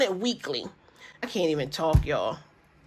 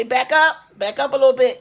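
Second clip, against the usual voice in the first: calling out back up; 3 semitones higher, 5 dB louder than usual. Shown as a demonstration. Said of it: "Sorry, I had to back y'all up a little bit".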